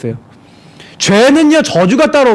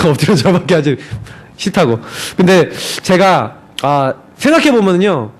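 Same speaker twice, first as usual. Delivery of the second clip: ordinary talk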